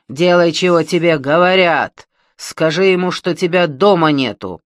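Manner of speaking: громкий злой медленный окрик; рявкнуть с угрозой. Acted. Колян кричит на Маню. (злобно орёт)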